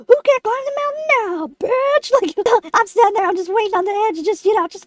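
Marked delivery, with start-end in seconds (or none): 0.1-2.1 high, crowing taunt; gloating taunt. Retold or imitated. [name] imagining taunting Carl from the top, gloating. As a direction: crow it as if showing him up.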